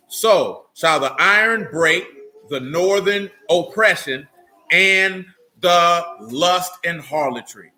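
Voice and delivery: slow, deliberate, each phrase set apart; slow emphatic reading. Shown as a demonstration. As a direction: lay the words down one by one. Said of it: "That's how you would want to view that".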